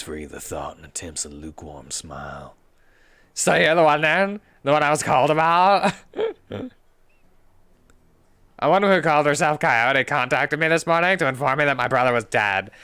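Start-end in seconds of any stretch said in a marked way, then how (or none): 3.3-12.7 higher, louder composed woman; brisk, formal young woman; Jessica voice. Acted. Jessica explains.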